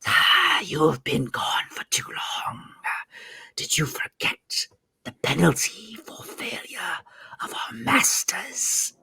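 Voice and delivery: slow, menacing whispered snarl; threatening snake villain, soft and very slow; snarling serpent. Acted. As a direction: hiss the warning in a drawn-out whisper. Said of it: "a snarl in his voice".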